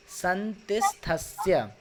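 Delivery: "संतिस्थस्य" read slowly, syllable by syllable; slow careful reading. Shown as a demonstration. Teacher reading the next Sanskrit word, slow. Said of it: "एक एक शब्द को पढ़ रहा हूँ".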